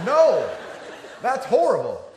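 laughing protest. Real, not acted. Reacting to friend's coma wish.

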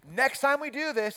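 high, slow, chipper announcement; real chipper. Retold or imitated. Coach introducing the next drill, real chipper voice. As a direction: announce it brightly to little kids. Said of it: "just like this real chipper voice".

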